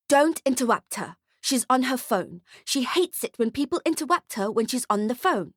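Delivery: put-on high, bossy child voice; high-pitched know-it-all brat; bratty little girl, scolding. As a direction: warn him off like a spoilt kid.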